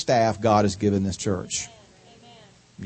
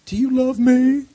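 imitating wife; 10 semitones higher than usual. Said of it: "she still wants to hear".

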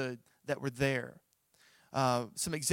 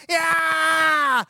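a high, loud shout straight at the birds; yelling at birds; 13 semitones higher, 11 dB louder than usual. Retold or imitated. Preacher fending off diving birds, high loud yell. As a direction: scream to scare the attackers off. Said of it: "I'm yelling at the top".

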